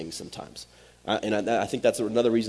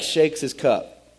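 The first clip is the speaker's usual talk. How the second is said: normal talking voice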